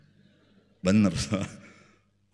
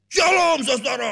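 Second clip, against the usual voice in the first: booming high shout of a fired-up preacher; shouted preacher welcome; loud preacher greeting; 11 dB louder, slower than usual. Retold or imitated. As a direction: belt out the greeting like an energetic pastor. Imitating energetic preacher's greeting.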